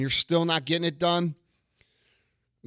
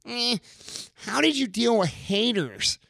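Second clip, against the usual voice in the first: higher, slow, sneering imitation of a fan; mocking whiny question; 8 semitones higher, slower than usual. Mocking fans who ask about haters, sneering. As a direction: parody the weak question with scorn. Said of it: "the quality of the question is".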